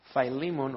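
Philemon said "FYE-lee-mon"; long eye sound in Philemon's first syllable; English name variant. Shown as a demonstration. Preacher offers an English name, Philemon as "FYE-lee-mon". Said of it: "otros dicen Philemon".

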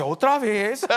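high, loud exclamation breaking into laughter; hammed-up complaint, played for laughs; mock exasperated spouse. Spouse answering a hungry wife, mock exasperation with laughter. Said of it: "la mayoría de la esposa dice".

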